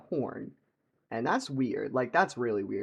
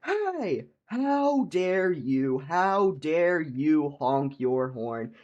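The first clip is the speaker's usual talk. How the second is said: high-pitched hey, then very slow outraged yell; mock screaming; 4 semitones higher, 4 dB louder, much slower than usual. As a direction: imitate an indignant man scolding, every word dragged out. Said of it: "screams at me".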